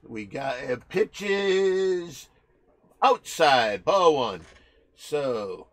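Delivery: sportscaster voice calling the pitches; playful baseball announcer; mock play-by-play. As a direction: call each pitch like a live broadcast.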